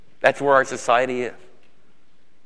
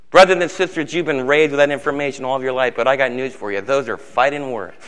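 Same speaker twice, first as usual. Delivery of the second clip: normal talking voice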